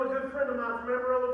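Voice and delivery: usual speaking voice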